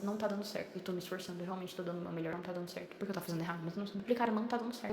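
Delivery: soft, defeated murmur to herself; despairing inner thought. Retold or imitated. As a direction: say it like you're about to give up. Inner despair, about to give up.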